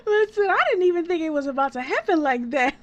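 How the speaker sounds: real laughter bursting through loud words; laughing. Real, not acted. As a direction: laugh it out, caught off guard. Caught off guard, laughing hard.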